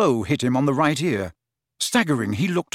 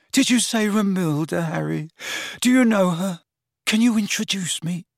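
high, dreamy eagerness; lovesick hope; 6 semitones higher than usual. Acted. Lovesick lad begging an introduction, hopeful. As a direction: plead in rapt hope.

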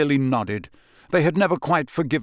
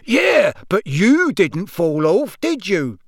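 louder, higher, drawn-out jovial boom; genial roar; 5 semitones higher, 4 dB louder, slower than usual. A narrator's reading. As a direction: roar with good-natured pride. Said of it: "roared Amos genially".

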